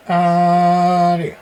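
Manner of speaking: ordinary talk, nothing marked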